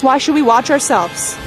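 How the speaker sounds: ordinary delivery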